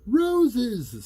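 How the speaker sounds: put-on high, loud kid answer; eager classroom answer; child voice. A narrator's reading. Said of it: "said Toby".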